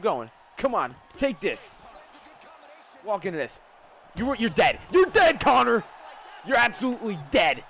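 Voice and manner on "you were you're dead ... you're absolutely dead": loud, high gloating yell; triumphant shout. Real, not acted. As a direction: shout it at a beaten opponent. Crowing over a knockout.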